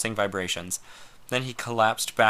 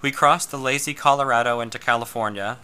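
usual speaking voice

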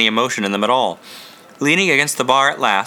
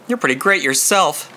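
plain reading voice

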